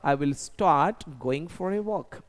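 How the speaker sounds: very slow, each word laid out for the class; slow model sentence. Shown as a demonstration. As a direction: dictate it slowly like a teacher. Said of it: "मैं walk पे जाना start करूंगा". (बहुत धीरे, साफ़-साफ़)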